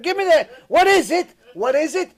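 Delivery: high, loud alarmed demand; father yelling at his son holding a knife; retold panicked shout. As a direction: yell it out like a frightened parent. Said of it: "I said".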